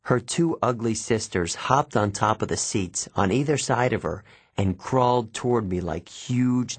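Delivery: usual speaking voice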